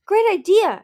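delighted and bright; happy. A narrator's reading. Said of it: "Anemone said happily".

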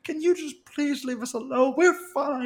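high-voiced mock appeal to back off; retold plea. Friend stepping into acted quarrel.

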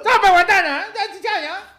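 high, loud put-on Chinese accent; mock chifa accent. Chifa worker calling out an order, high mock Chinese accent. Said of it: "en un idioma chifero".

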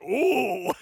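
loud swooping "ooh"; mock dramatic "ooh". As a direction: swoop an exaggerated, mocking "ooh". Retold dramatic change in film.

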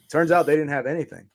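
ordinary talk, nothing marked

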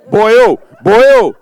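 loud, high, hammed-up Welsh lilt; exaggerated Welsh caricature; mock Welsh accent. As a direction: belt it out in a comic Welsh accent. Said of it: "That's my best Welsh accent".

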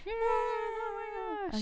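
high, very slow gasp; slow high squeal. Real, not acted. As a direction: stretch it out way up high. Excited squeal.

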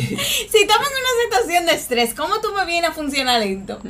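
high-pitched exasperated protest through a laugh; mock exasperation. Protests at slow teammate under stress.

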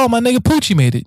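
high and quick, glad to see a friend; excited greeting. Retold or imitated. Reek greets Poochie arriving at the party, excited and high. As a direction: burst out happy he made it. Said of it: "I was like".

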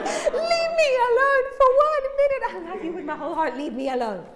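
high, loud half-laughing wail; exasperated plea. Retold or imitated. Mom pleading through the door with her toddler. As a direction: mock-desperate cry that softens with love. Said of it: "And I'm like".

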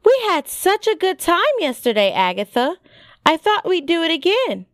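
high-pitched, wheedling friendliness; coaxing. A narrator's reading. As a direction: win over a sulking friend. Sophie coaxes her sulking friend.

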